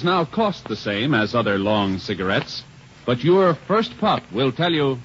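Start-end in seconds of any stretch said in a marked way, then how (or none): none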